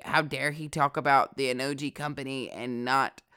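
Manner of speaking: lightly voiced, mock-scandalized skater; a hint of clutching-pearls skater outrage; mock outraged skater. Mocking skaters offended by his mispronunciation, mild outrage. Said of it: "a lot of skaters are gonna be like".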